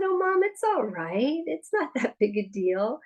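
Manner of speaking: put-on high, light little-boy reassurance; a small child comforting his mom; young son's voice. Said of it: "he said".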